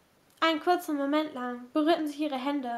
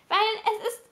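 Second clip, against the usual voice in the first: high, hesitant objection slowing down; faltering protest; 6 semitones higher than usual. A narrator's reading. Kate's resistance to Gabriel melts, faltering protest. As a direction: struggle to finish the protest. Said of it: "Caitlins Widerstand schmolz".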